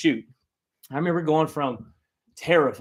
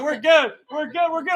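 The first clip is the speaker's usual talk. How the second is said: dismissive operator; 13 semitones higher, 6 dB louder than usual. Retold or imitated. Operator brushing him off.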